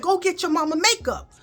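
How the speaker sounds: uncle asking. Retold or imitated. Uncle quoted in the story.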